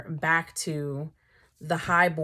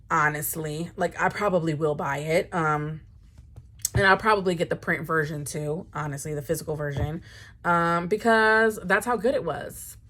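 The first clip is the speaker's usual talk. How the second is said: ordinary talk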